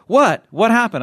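loud, high, urgent questions; imitated alarm. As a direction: mimic someone jumping at the bait. Retold Manny taking the bait.

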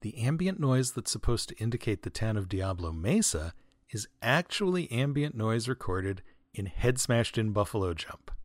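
ordinary talk, nothing marked

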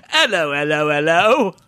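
loud, hammed-up English hellos; cartoon Cockney accent greeting; bad English accent. Shown as a demonstration. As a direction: mimic a generic English movie character, loudly. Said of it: "bad English accent".

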